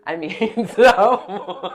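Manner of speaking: slow, loud, laughing fix of the word; laughing correction. Real, not acted. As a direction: laugh through the self-correction.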